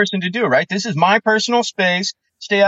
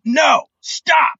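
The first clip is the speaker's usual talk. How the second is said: firm command; 8 semitones higher than usual. Shown as a demonstration. Showing cohost how to stop a dog, with a sharp command. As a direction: bark it like dog training. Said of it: "a little bit of tone of voice goes a long way".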